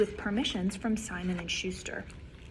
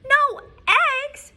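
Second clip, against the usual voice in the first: much higher, loud shocked cry; cried out; 9 dB louder than usual. Acted. Farmer Brown cries out hearing the hens strike. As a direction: yelp it in disbelief. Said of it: "cried Farmer Brown".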